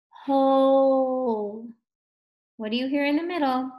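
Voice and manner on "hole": HOLE stretched long and loud; drawn-out slow. Shown as a demonstration. Teacher showing pupils the middle sound, drawn-out slow HOLE. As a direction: hold the O in HOLE on a steady note. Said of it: "Can you say that slowly".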